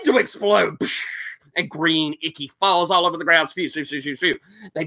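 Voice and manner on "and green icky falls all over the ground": normal talking voice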